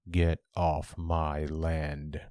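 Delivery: low, drawn-out ghostly warning; low menacing voice. Retold or imitated. Ghost warning visitors off his land, low and menacing. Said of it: "a low, menacing voice".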